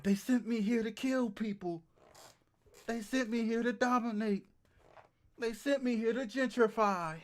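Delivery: high, loud, hammed-up proclamation; mock-dramatic revelation. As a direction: declare your secret mission in mock alarm. Mock realisation of secret British mission.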